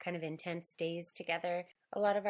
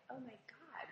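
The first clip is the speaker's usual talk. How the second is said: whispered, voiceless and much softer; retold whisper; 7 semitones higher, 13 dB quieter than usual. Host retelling her surprise at starting to cry, whispering. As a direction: hush it like a shocked gasp. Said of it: "all of a sudden it was like".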